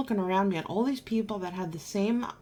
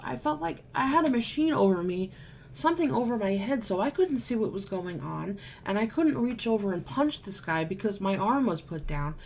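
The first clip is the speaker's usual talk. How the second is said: ordinary talk, nothing marked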